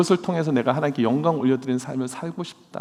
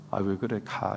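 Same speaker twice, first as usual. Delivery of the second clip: mock brush-off; 7 semitones lower, 11 dB quieter than usual. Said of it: "발로 이렇게 툭툭 치십니까".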